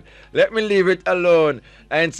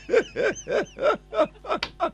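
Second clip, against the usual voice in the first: high squealing laugh; 4 dB quieter than usual. Real, not acted. Host laughs at the picture.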